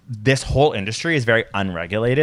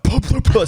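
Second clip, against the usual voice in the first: powder puff sounds; 12 semitones higher, 5 dB louder than usual. Acted. Mimics dry powder puffing.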